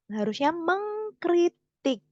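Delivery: mengkritik said slowly with the k kept; correct form. Shown as a demonstration. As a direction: spell out mengkritik carefully, keeping the k sound. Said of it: "Bukan mengkritik, tapi mengkritik".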